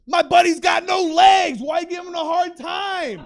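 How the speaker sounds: high, loud, slow hammed-up yell; indignant yelling. Friend defending buddy to Uber driver, indignant yelling.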